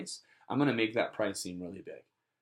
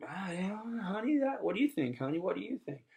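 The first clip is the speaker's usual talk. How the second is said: unsure customer; 9 semitones higher than usual. Acted. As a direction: play a customer checking with honey in a high little voice. Acted couple consulting each other.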